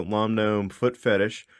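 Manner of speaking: normal talking voice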